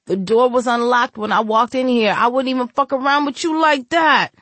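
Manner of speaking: high, frantic pleading voice; pleading young man. Acted. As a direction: beg desperately for your life. Intruder begging for his life.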